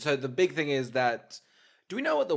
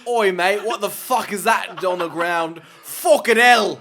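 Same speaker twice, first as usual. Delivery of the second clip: put-on loud, rowdy British geezer accent, pitched up; 3 semitones higher, 10 dB louder than usual. Loud mock British accent to the co-host about the blood. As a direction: bark it out like a London lad. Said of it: "It's such a British accent".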